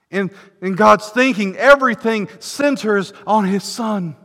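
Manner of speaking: preacher exalting God's love before the church; climactic preaching. Acted. Preaching at full force.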